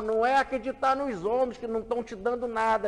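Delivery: usual speaking voice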